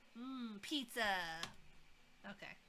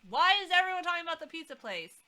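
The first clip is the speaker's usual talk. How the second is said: exasperated; 6 semitones higher, 8 dB louder, faster than usual. Real, not acted. Amused complaint at game.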